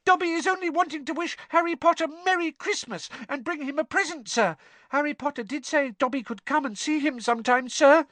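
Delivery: very high squeaky elf voice; anxious squeak. A narrator's reading. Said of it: "squeaked Dobby anxiously".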